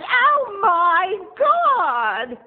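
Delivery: shrieking mock hysterics, very high and loud; hysterical woman. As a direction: screech it like a drama queen. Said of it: "she goes".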